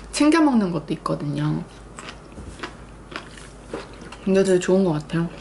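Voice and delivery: talking between bites; mouth full, chewing. Real, not acted. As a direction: food held at lips. Seen 0: Chews during opening words, then talks holding food at lips.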